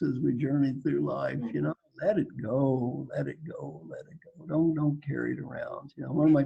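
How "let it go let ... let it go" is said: each let it go softer until a whisper; fading repetition. Shown as a demonstration. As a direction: let it fade away to a whisper. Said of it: "repeats advice, fading away".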